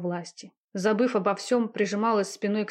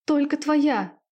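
loud high scream of ecstasy; 7 semitones higher, 4 dB louder, much slower than usual. A narrator's reading. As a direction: scream it out at the peak, much higher. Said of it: "кричала девушка".

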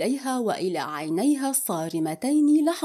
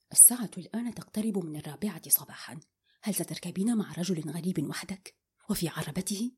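sharp hushed; 4 semitones lower, 9 dB quieter, faster than usual. A narrator's reading. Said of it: "قال لها بحدة".

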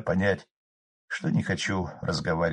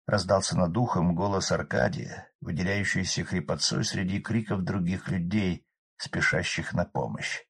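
ordinary delivery, nothing marked

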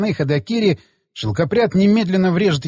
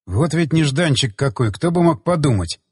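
ordinary delivery

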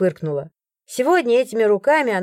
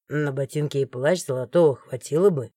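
guard interrupts; 6 semitones lower than usual. A narrator's reading. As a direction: cut in low and firm. Morgan, the guard, speaks. (низко и твёрдо перебивает)

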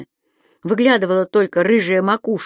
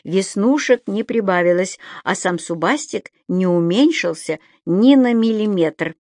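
usual speaking voice

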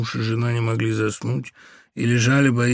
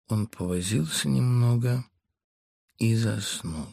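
ordinary delivery, nothing marked

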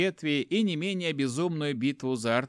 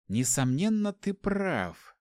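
thoughtful; 5 semitones higher, slower than usual. A narrator's reading. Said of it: "задумчиво проговорила Зельда".

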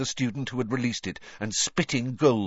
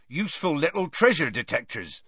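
happy; 6 semitones higher than usual. A narrator's reading. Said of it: "said Hagrid happily".

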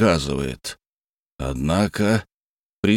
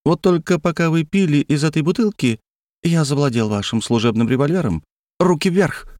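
alien voice; 7 semitones higher than usual. A narrator's reading. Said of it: "согласился один из Фнулов".

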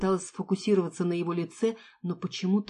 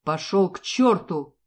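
slow, louder furious curse; angry father; 6 dB louder, slower than usual. Acted. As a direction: spit it out at the mercenary. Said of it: "раздался гневный голос отца". (гневный голос отца)

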